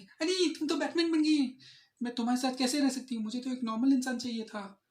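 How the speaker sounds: high, loud mock outrage of a girlfriend; complaining girlfriend act. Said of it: "तो बोलने लगी". (Girlfriend की ऊँची शिकायती नकल)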